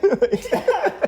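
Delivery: cracking up; high laugh. Real, not acted. Laughing at the age question, high laugh.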